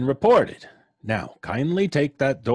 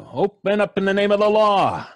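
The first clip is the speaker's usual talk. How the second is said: higher, louder police command; 7 semitones higher, 4 dB louder than usual. Acted. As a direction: order them to open. Cop demanding entry.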